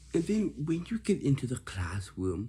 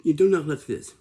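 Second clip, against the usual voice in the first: 1 stern scolding; 5 semitones higher, 11 dB louder than usual. Acted. Teacher scolding rude faces.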